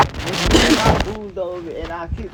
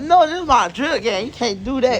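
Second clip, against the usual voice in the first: loud protest; 7 semitones higher, 6 dB louder than usual. Retold or imitated. Kid protests losing his gear.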